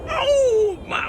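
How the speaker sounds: a real thrilled gasp of oh; excited high oh. Real, not acted. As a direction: cry out in delight. Delighted at the fruit.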